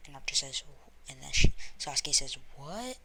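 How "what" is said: high, rising what; surprised question. Acted. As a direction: ask it in mild disbelief, pitch climbing. Sasuke reacts to the training.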